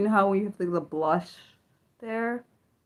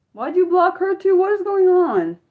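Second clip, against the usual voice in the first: much higher and louder, calling out in disbelief; bewildered raised-voice protest; loud exasperated call; 12 semitones higher, 7 dB louder than usual. Real, not acted. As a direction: demand an answer across the room. Friend blocking people in chat.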